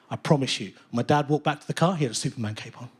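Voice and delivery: usual speaking voice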